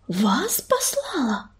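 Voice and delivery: slow, astonished whispered question. A narrator's reading. Healer hearing the ancestors sent them, whispering in amazement. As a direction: whisper it in disbelief, drawn out. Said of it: "прошептала Пепелица".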